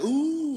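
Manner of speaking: high, loud, sustained "ooh"; shocked crowd "ooh". Retold or imitated. Crowd reacting to the argument, high mock "ooh". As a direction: imitate a scandalized audience. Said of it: "The crowd's like".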